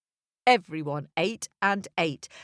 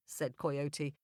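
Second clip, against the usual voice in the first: usual speaking voice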